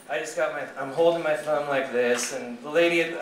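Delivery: usual speaking voice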